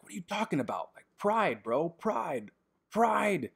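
high, loud excited yelling. Retold or imitated. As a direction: shout it like an excited fan. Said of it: "why do I keep yelling out pride".

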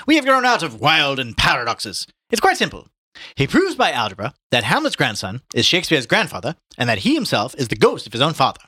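high, loud theatrical pronouncement; witty showman Mulligan, mocking. A narrator's reading. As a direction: proclaim an absurd theory with lively swoops.

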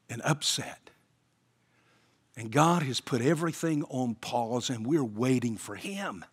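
soft, intimate sermon close; quiet appeal. Acted. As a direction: draw the congregation in gently and softly. Quiet closing appeal to listeners.

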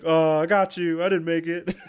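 high, loud, laughing backdown; sheepish son. Retold or imitated. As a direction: cave in with a nervous laugh.

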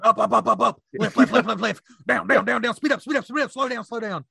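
hammed-up angry instructor barking orders; angry man yelling. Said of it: "some angry man going".

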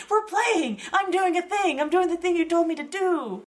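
high, eager voice of a playful dog; happy dog voice. Retold or imitated. A dog on set, eager to please, in a high happy voice. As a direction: bounce it up high like a proud pup. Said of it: "Dog's like".

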